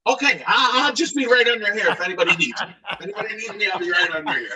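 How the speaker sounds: slink away with an awkward excuse; acted meek retreat; sheepish backing off. Sheepish exit after embarrassing outburst.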